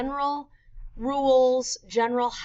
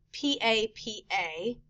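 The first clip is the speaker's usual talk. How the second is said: ordinary delivery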